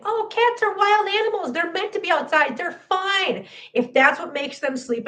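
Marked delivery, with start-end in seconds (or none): 0.0-3.5 high, sing-song mimicry of dismissive people; mocking quote. Vlogger mimics people who leave cats outside, high mocking voice. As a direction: parody the people who shrug it off, pitched up. Mocking careless cat owners.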